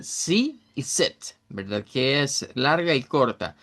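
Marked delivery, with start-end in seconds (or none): 0.0-1.4 sí and set drawn out slowly, vowels held long; vowel length demo. Shown as a demonstration. Teacher stretching sí and set to show vowel length. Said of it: "La longitud de la vocal puede cambiar".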